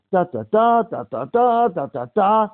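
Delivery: loud, high, animated babble; loud chatter. Retold or imitated. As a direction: rattle it off like an excited talker. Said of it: "my father's talking the way I'm talking".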